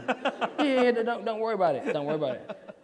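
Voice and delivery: high, loud, laughing brush-off; breezy director reassurance. Retold or imitated. Director brushing off the actor's doubts, high and laughing. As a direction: wave the worry away breezily. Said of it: "And you're like".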